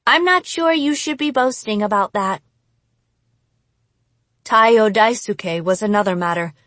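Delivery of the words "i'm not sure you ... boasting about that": higher-pitched woman's character voice; doubtful, higher-voiced rebuke; disapproving companion. A narrator's reading. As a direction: gently scold the boaster. Companion dryly rebukes the boaster.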